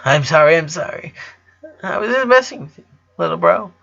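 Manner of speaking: high, rushed, pleading apology; near tears. A narrator's reading. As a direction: apologise fast, on the edge of tears. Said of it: "She looked like she was about to cry".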